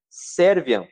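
"Serviam" dito alto e firme, como um grito de guerra; brado contrário. Retold or imitated. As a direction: proclame "Serviam" com força. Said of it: "você não dá um brado contrário". (brado firme de "Serviam")

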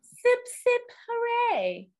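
high, loud mock hooray; playful cheer. Co-host prompts a sip of wine, playful high cheer.

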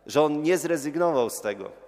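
ordinary talk

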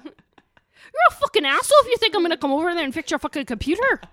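loud, high angry Scottish rant; Scottish swearing demo. Shown as a demonstration. Showing how to cuss at work, loud Scottish accent. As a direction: swear like a furious Scot. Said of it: "you need to be like".

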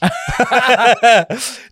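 high burst of laughter; big laugh. Real, not acted. Laughing after TikTok mock.

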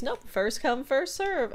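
lightly voiced defiant little kid; Gideon. Gideon refusing to give Stan the chair, mock bratty. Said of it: "he goes".

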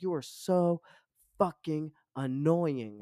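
high, loud slow demon snap; demon snapping. Retold or imitated. The possessed friend snaps at the narrator, slow demonic snarl. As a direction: spit it out slowly like a demon. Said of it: "she looked me square in the face and she was like".

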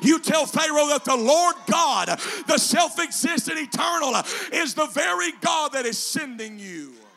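loud, high, chanted preaching; preaching at full force. Acted. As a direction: whoop it to the congregation. Preacher builds to climax.

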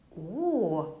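astonished oh. A narrator's reading. Mouse discovers strange tracks in the snow, astonished. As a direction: gasp it out in wonder. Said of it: "rief sie erstaunt".

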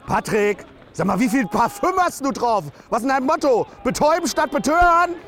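shrill fan teasing player Andi; shrill heckler. Acted. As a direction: needle him from the stands. Heckle about perfume.

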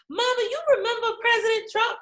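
put-on high, fast little kid's voice; curious kid. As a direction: pipe up high like a child asking mom. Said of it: "our kids are gonna come home and be like".

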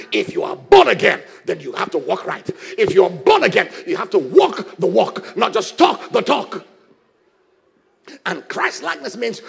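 loud, driving preacher's cadence; a full-force pulpit sermon; fiery preaching. Acted. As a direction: preach it hard to the congregation. Preaching builds again to full force.

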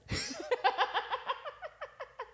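high laugh, trailing away; real laughter. Real, not acted. Cracking up at the wrong-number story, real laughter.

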